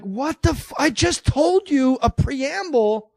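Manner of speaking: high, building hammed-up shout; mock rant. Shopper to the Ralph's cashier, hammed-up high shout. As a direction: rant at the cashier, rising into a yell.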